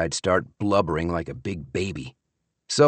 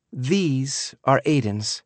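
mildly proud, higher daughter voice; understated pride in a higher-pitched voice; 5 semitones higher, slower than usual. A narrator's reading. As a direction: show them off with a touch of pride. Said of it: "Maggie said proudly".

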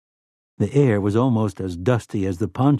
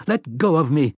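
higher pained protest, not fully shouted; crying out; 5 semitones higher than usual. A narrator's reading. Slightman begs the robot to release him, crying out. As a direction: cry out to be released. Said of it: "Slightman cried out".

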